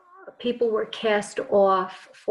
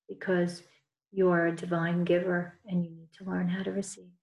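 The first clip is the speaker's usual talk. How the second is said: guided meditation. Acted. Leads group healing meditation.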